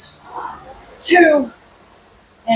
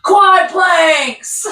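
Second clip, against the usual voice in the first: "quad planks" laughed out loud and high; laughing; 7 semitones higher, 3 dB louder than usual. Real, not acted. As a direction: grin through "quad planks". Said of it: "one of my personal favorites".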